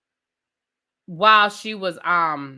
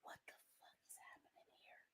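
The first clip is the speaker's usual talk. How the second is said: hushed, unvoiced whisper of disbelief; 43 dB quieter, faster than usual. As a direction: whisper it as if stunned in your seat. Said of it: "And I was like".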